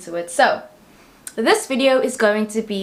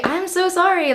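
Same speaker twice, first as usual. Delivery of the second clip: sorry pushed much higher; high emphatic apology; 9 semitones higher than usual. Real, not acted. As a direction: plead it high and bright. Repeated apology, pitched up.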